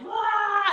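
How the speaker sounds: high-pitched mock "wah"; frustrated wail. Showing the group how she shakes off frustration, mock frustrated wail. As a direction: let out a high, drawn-out cry of frustration. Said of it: "when I get really super frustrated".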